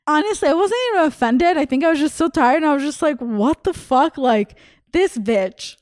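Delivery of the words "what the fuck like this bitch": slow, drawn-out disbelief; incredulous inner thought. Retold or imitated. Comedian recalling her slow disbelieving thought about the rude fan. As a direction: let it sink in slowly.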